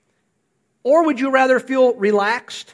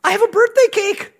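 happy thought; 12 semitones higher, 4 dB louder than usual. Retold or imitated.

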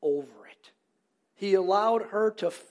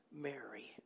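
tender whispered name, low and soft; whispered gently, as Jesus calling Mary; 7 semitones lower, 19 dB quieter than usual. Retold or imitated. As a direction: breathe her name like a secret of love.

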